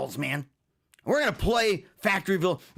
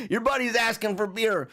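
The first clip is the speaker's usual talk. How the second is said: ordinary talk, nothing marked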